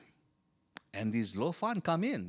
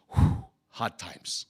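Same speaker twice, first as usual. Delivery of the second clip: weary sigh; 7 semitones lower, 3 dB louder, much slower than usual. Real, not acted. Sighing at hardship.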